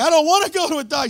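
mock whining refusal of the doctor; whiny protest. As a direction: whine like a stubborn kid refusing the doctor. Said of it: "And I'm like".

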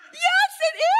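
very high, loud squealed reply; excited outburst. Retold or imitated. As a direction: shout it in hammed-up delight. Acts out her excited answer.

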